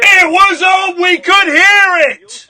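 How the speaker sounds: slow, very high, loud yelling; angry shout. Real, not acted. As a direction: shout the caller down word by word. Host shouts over the caller.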